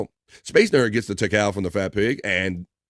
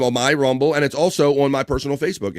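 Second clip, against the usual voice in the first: normal talking voice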